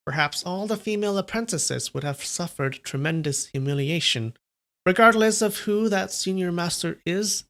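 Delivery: high voice of a woman in her fifties; older woman voice. Acted. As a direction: play a warm older wife. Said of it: "how do I do the female voice again".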